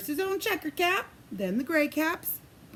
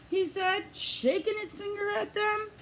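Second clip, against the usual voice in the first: plain reading voice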